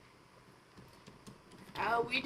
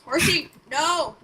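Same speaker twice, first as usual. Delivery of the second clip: mock cry; 3 semitones higher, 9 dB louder, slower than usual. Mock alarm for horse.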